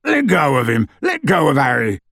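indignant demand. A narrator's reading. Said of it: "said Hagrid indignantly".

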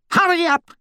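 very high screeching command; screeched. A narrator's reading. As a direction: screech it impatiently. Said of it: "a loud voice screeched".

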